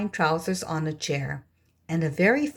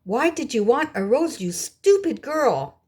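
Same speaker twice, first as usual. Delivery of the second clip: higher, scornful scolding; scolding sisters; 6 semitones higher than usual. A narrator's reading. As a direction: nag her as the spiteful older sisters, pitched up. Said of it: "cried the older sisters".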